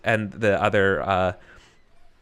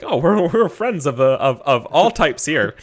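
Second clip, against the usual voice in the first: placating innkeeper; 5 semitones higher, 5 dB louder than usual. Acted. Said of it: "immediately being like".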